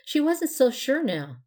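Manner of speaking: plain reading voice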